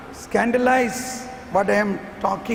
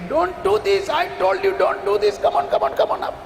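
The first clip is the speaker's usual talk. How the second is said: urgent warning; 7 semitones higher, much faster than usual. Acted. God voiced warning them.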